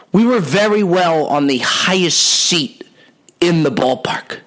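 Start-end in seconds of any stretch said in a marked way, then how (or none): 3.4-4.4 whispered aside. Real, not acted. Awed about high seats.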